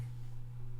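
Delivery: a faint breathy exhale; soft sigh. Real, not acted. Vlogger processing the clip, soft sigh. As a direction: sigh it out quietly, taking it in. Processing what he just watched.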